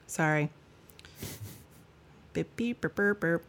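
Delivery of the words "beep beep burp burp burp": robotic glitch noises. Pretend. Mock glitch noises after reading.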